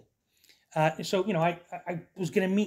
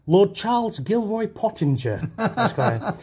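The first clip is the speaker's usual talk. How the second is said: grand introduction; 5 semitones higher, 4 dB louder, slower than usual. Acted. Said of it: "grand introduction".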